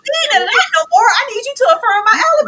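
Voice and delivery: high, loud, insistent outburst; desperate mock shout. Podcaster playing man demanding his alibi, desperate shout.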